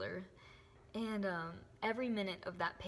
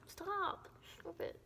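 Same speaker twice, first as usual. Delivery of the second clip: playful puppy scolding; 10 semitones higher, 4 dB quieter, slower than usual. Real, not acted. Playing with her puppy Charlie.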